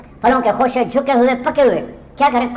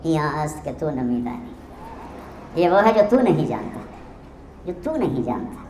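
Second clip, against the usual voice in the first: innocently; 5 semitones lower, 7 dB quieter than usual. Retold or imitated. Dervish answers the stunned scholar softly and innocently. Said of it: "بڑی معصومیت سے بولے".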